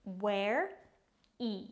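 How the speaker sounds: "ware-y" split into two slow syllables; slow teaching demo of "ware-y". Shown as a demonstration. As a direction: sound out "ware-y" with a gap before the y.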